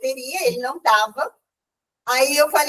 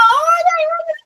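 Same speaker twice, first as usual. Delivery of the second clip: much higher, louder mock cry of olha; retold shouting; 4 dB louder, faster than usual. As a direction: imitate the crowd yelling excitedly. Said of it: "todo mundo começou a gritar". (imitando a turma gritando, bem agudo e mais alto)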